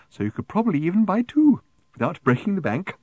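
high, prim and coaxing; prim raffle seller. A narrator's reading. Woman nudging Arthur to buy two, prim and coaxing. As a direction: lilt it up high, primly persuasive.

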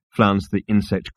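ordinary delivery

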